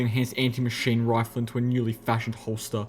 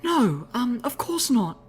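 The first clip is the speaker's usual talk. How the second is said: high ladylike voice, hurriedly reassuring; 13 semitones higher, slower than usual. A narrator's reading. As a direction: reassure the newcomer in a high, feminine voice. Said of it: "she assured the newcomer".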